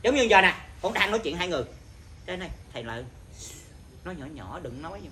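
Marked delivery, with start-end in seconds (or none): 2.3-5.0 much softer, hushed polite reminder; gentle reminder. Shown as a demonstration. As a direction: demonstrate quietly asking someone to keep it down. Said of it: "nói nhắc nhở khách vậy".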